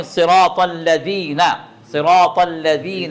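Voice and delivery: slow الذین with the tongue tip out between the teeth; الذین میں زبان باہر نکال کر ذ. Shown as a demonstration. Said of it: "زبان باہر نکالیں".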